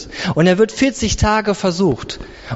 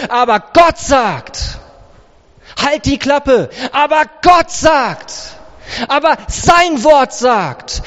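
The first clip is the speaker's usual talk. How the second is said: forceful, raised, emphatic comeback; defiant rebuttal; 3 semitones higher, slower than usual. Acted. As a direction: silence the accuser with conviction. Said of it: "in Zukunft sagst du". (energisch und trotzig entgegnet)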